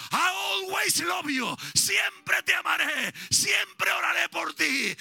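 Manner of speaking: preacher shouting his love to absent members; full-force preaching. Acted. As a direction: preach it with fire.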